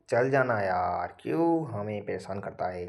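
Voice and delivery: slow, drawn-out playful plea; mock pleading. Teacher begs stubborn software to work, mock pleading. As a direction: drag out the words, begging half in jest.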